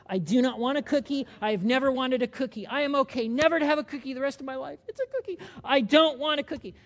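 loud, insistent, rising refusal; mock stubborn denial. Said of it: "a distorted response would be to say".

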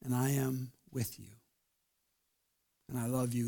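soft, hushed and tender; soft reassuring whisper of love; tender voice of God. Retold or imitated. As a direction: speak as a loving God, gently. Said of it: "God's saying".

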